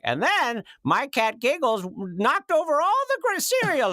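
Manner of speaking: high, slow, rambling fan voice. Retold or imitated. Mimicking a booth barnacle telling a cat story, high and slow. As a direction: play the chatty fan who won't leave. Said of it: "it's just someone like".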